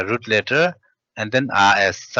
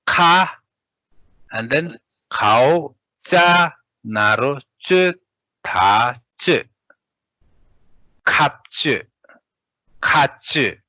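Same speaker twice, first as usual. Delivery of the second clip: each letter named in turn, then joined; teacher spelling out for the class; spelling drill; 3 semitones higher than usual. Shown as a demonstration. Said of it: "let's read spell out".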